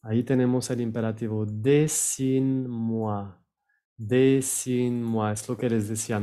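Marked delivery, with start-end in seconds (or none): 1.5-5.3 "Dessine-moi" slow, no vowel between n and m; French pronunciation demo. Shown as a demonstration. Teacher models the imperative, "Dessine-moi" with silent E. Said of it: "No vayan a pronunciar la E al final".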